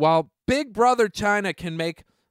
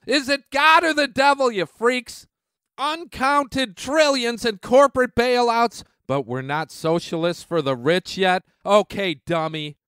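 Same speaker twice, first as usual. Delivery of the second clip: ordinary talk, nothing marked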